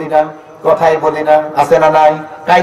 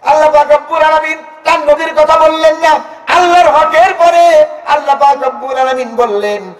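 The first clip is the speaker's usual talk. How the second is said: climbing high sing-song sermon; chanting praise of the Prophet; fiery preaching; 10 semitones higher than usual. Acted. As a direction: build it up and hold it high. Preaching cadence returns.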